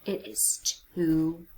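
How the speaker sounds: soft frightened hush; a little spooked whisper; scared whisper. A narrator's reading. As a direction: shrink away in fear. Pete scared of fairy tale.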